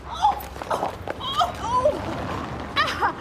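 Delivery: high, wordless moans of distress; high wailing ohs; distressed cries. Acted. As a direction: cry out helplessly, voice pitched right up. Cries out after row with Fred.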